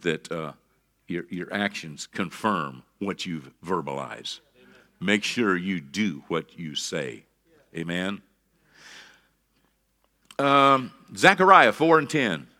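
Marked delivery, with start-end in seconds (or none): none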